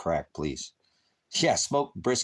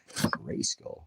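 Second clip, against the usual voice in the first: whispered; 6 dB quieter than usual. Pretend. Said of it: "whispered name after the impression".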